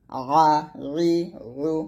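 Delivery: ordinary delivery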